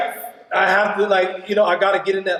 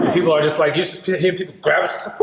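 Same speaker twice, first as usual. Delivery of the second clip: usual speaking voice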